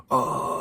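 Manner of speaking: guttural metal growl; short heavy-metal growl; guttural scream. Shown as a demonstration. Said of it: "that really guttural".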